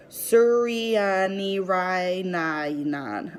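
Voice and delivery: Suryanirainayanan spelled out very slowly and carefully. A narrator's reading. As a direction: draw out Suryanirainayanan clearly, syllable by syllable. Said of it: "I say slowly".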